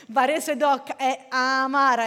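loud Barese with a long A in "amara"; genuine Bari accent. Shown as a demonstration. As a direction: stretch the A of "amara" like a true Bari native. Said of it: "è la A che è allungata". (barese doc, con la A allungata)

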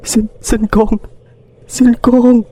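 put-on high, tearful begging of an old woman; old woman sobbing to a ghost, begging; sobbing plea. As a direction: weep out the plea to the dead girl. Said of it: "bà ta lại nức nở khóc".

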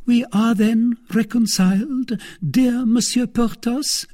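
high-pitched coy wife; simpering. A narrator's reading. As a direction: simper sweetly at a reconciled lover.

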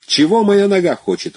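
high, bright exclamation; exclaiming. A narrator's reading. The gentleman boasting to Luzhin, excited. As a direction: toss it out with gusto. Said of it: "воскликнул господин".

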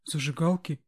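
as if asked for an atom bomb; incredulous. Acted. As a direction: echo it in disbelief. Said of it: "переспросил сторож таким тоном, как будто я спросила".

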